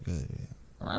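very low, faint sleepy mumble; drugged, half-asleep murmur; groggy dog. Retold or imitated. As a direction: imitate someone barely waking up. Imitates drugged dog half waking.

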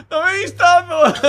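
put-on very high, slow wailing kid's cry; mock crying yell. Crying friend running after him at the door, wailing. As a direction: howl it like a sobbing child chasing you. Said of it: "venía corriendo para mí llorando".

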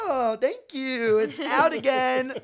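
exaggerated slow, high thank-you; mock gratitude. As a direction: overdo the relief, drawn out. Mock thanks after being corrected.

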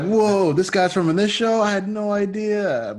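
high, slow, wide-eyed amazement; amazed surprise. Retold or imitated. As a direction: gasp it out like a stunned kid. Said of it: "like, I was like".

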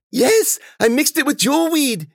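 very high, excited character voice; eager servant. Acted. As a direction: pipe up loudly and proudly. Eager servant answers his mistress.

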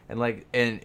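ordinary delivery

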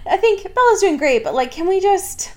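high-pitched mock plea; high, half-joking appeal; acted Jacob. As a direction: push the pitch up, asking to stop. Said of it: "Jacob is like".

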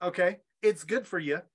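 ordinary talk

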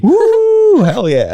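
loud high whoop; cheering. Real, not acted. Cheering for Coach Amy.

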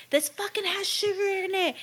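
high-pitched imitation of an irate customer; mock outrage. Imitating a lady complaining to Starbucks staff, mock outrage. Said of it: "she's, like, freaking out".

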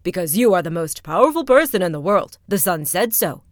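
loud, puffed-up proclamation; proud rat king. Acted. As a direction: boast of the princess grandly. Rat King proposes to cloud.

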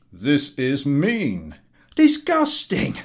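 loud, slow outrage; revolted public. Acted. Passengers to the collector, revolted. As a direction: spit out disgust.

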